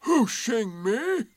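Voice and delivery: slow, high, loud rising question; Eeyore, surprised. A narrator's reading. Donkey querying the rabbit's claim, loud and surprised. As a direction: stretch the words in affronted disbelief. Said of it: "said Eeyore in surprise".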